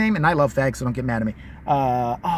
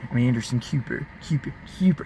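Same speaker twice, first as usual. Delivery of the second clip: Anderson Cooper; 8 semitones lower, 6 dB quieter than usual. Acted. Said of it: "with his weird accent".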